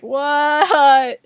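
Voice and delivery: loud, drawn-out baffled question; mock disbelief. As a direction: wail it like a baffled child. Acts out disbelief after hitting someone.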